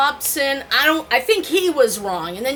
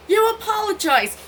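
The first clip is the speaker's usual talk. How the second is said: mocking husband's demand; 11 semitones higher than usual. Husband following her demanding apology.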